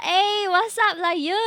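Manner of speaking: loud playful Singlish with lah. Pretend. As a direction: greet them in Singlish, laughing. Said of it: "Singlish redeem".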